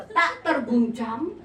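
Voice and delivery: slow, measured verse line; pantun recitation. Shown as a demonstration. Preacher recites pantun to learn intonation, slow declaiming. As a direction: declaim it slowly, stretching the words. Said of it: "Karena mau berpantun".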